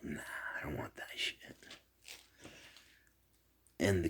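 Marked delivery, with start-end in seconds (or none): 0.0-1.4 hushed whisper; whispered cat voice. Retold or imitated. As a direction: whisper the cat's line. Cat's reply in a dream story.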